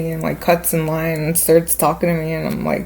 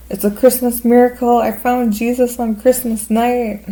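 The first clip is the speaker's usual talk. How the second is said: excited announcement; 6 semitones higher, 3 dB louder than usual. Retold or imitated. Drunk friend announcing she met Jesus, high and excited. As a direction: imitate a friend proclaiming a miracle.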